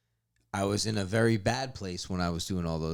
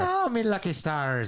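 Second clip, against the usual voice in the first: high leprechaun-style Irish; mock Irish accent; 8 semitones higher, 4 dB louder than usual. Guest joining accent joke, cartoon Irish.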